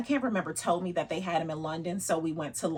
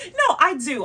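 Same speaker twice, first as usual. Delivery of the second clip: much higher, laughing through it; laughing protest; 14 semitones higher, 8 dB louder, slower than usual. Real, not acted. Vlogger laughing as she insists on loving boots, higher. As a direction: giggle while insisting. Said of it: "You hear how my voice went up?".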